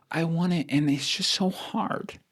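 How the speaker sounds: high, drawn-out mock whine; mock whining. Mimicking people who say they want it but it's hard, whiny. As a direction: imitate a soft, pleading whinger.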